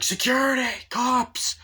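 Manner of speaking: very high, loud mock yell for help; shouting for help. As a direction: scream for security. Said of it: "He's like".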